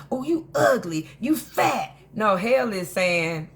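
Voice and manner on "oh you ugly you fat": mock insult. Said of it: "Is hell like saying".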